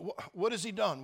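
ordinary talk

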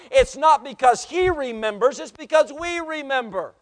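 loud, impassioned pulpit delivery; full-force preaching. Real, not acted. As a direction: drive it home to the congregation, loud.

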